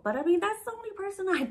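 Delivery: usual speaking voice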